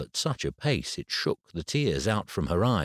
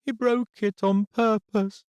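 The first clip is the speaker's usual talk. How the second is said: put-on much higher, slow child's voice near tears; a hurt girl accusing the boy; tearful little girl; 4 dB louder than usual. A narrator's reading. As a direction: daughter telling her dad through tears.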